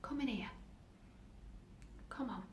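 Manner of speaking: soft, gentle invitation, almost whispered; very kindly. Acted. Doctor invites the girl in, very kindly. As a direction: coax gently, in a hushed voice. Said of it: "She said very kindly".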